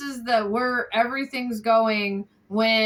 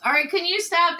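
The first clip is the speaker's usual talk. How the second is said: sharp, raised, fed-up ask; irritated; 6 semitones higher, 3 dB louder, faster than usual. Real, not acted. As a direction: snap at the noisy person. Annoyed at family member's noises.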